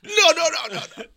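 high, loud rapid-fire refusals; mock panicked protest. Fan acting out the horrified reaction, laughing protest. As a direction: shout it down, laughing through it.

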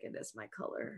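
whispered, almost unvoiced; whispering. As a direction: breathe it like a secret. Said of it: "Say that again".

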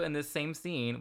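usual speaking voice

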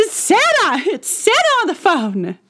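high-pitched imitation of a thrilled kid; excited. Older child thrilled that Santa is calling, high excited mock squeal. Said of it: "they were just so excited".

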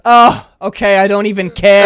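loud, high, hammed-up surrender; big melodramatic outburst; swept-away movie lover. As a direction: throw it out in mock abandon.

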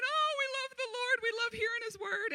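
high, loud, tearful gush of joy; overjoyed crying crowd. Retold or imitated. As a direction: voice the people weeping for joy at the word. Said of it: "They're crying".

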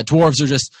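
normal talking voice

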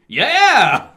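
high, loud whoop; hammed-up cheer. Reading the script's cheesy yeah, loud high whoop. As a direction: blast it out like a cartoon cheer.

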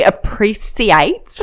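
"appreciate" stretched out slowly, with an s in the middle; demonstrated pronunciation. Shown as a demonstration. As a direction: say "appreciate" very slowly, sounding "see-ate". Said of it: "if you could just say for me".

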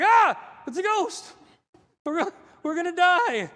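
hammed-up terrified shout, high and loud; freaking out. Fishermen seeing a figure on the water, freaking out. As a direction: scream it in mock panic. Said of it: "we're freaking out".

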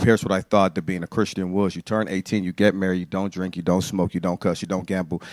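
ordinary talk

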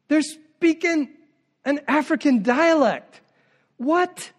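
high, slow, puzzled onlooker voice; bewildered bystander. Retold or imitated. Preacher voicing confused crowd, high and bewildered. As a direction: play the baffled visitor, a little mocking.

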